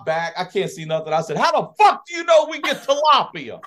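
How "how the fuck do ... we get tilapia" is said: high, loud retold outburst; worked-up re-enacted yell; losing it. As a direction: explode in exasperated disbelief. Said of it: "I lost my shit".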